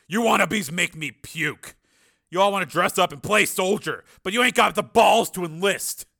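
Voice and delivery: army sergeant's loud scorn; contemptuous sergeant. Acted. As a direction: chew out the wannabe. Narrator voices grimacing, contemptuous sergeant.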